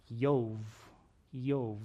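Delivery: Yov said as one syllable, YOV with a long o; Hebrew name Yov. Shown as a demonstration. Preacher showing how Job's name sounds in Hebrew, careful pronunciation. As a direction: pronounce Yov softly, single syllable. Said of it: "Job's name is pronounced".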